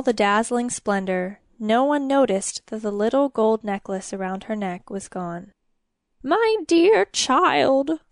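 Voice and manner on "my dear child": high, slow choked-up cry of joy; sobbing. A narrator's reading. King sobbing to his daughter. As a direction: overcome king sobbing over her. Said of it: "the king sobbed".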